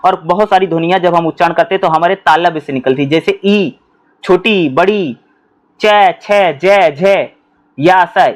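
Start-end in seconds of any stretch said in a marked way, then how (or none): 3.1-8.3 च, छ, ज, झ said crisply; teacher sounding out letters; palatal sounds demo. Shown as a demonstration. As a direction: sound out each palatal clearly. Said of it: "ये तालुब से".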